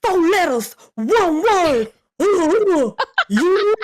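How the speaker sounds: high, drawn-out catchphrase call; Jey Uso. Retold or imitated. Co-host does the wrestler's catchphrase for Norma, hyped impersonation. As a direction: hit each phrase high, then let it drop. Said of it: "That was a fantastic impersonation".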